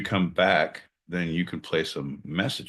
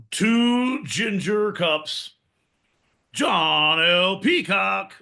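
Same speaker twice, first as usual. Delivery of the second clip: booming ringmaster introduction, slow and high; 9 semitones higher, 4 dB louder than usual. Acted. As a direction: announce him like a circus ringmaster. Ringmaster-style intro of John.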